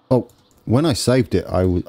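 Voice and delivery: ordinary delivery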